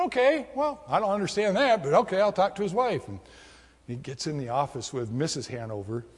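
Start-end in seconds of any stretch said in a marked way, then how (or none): 0.0-3.1 high surprised okay, then bemused; puzzled surprise. Retold or imitated. Roger answering the secretary, puzzled and surprised. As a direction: sound thrown but willing.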